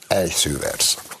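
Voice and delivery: ordinary talk, nothing marked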